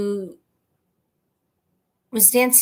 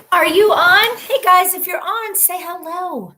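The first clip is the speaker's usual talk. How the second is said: calling out; 8 semitones higher, 5 dB louder than usual. Real, not acted. Calling out to viewers.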